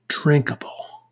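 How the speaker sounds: breathy whispered ending. Acted. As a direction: whisper the last word. Whispered end of label.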